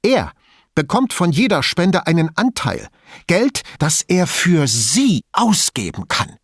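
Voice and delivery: high, emphatic, bitter accusation; reckless angry tirade. A narrator's reading. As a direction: charge on regardless, hammering the key words. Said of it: "fuhr sie ohne Rücksicht auf Verluste fort".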